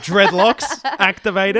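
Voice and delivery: slow, laughing announcement; loud laughing tease; laughing mock hype. Teasing wedding dance.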